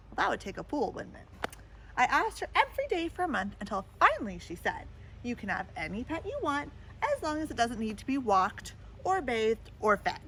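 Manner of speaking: storybook read-aloud voice; lively picture-book reading; story narration. A narrator's reading. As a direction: tell it to a child at bedtime.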